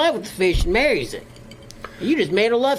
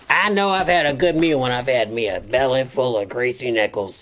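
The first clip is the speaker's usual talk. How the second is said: put-on a deep old country critter; low possum voice; 10 semitones lower than usual. Possum character voice.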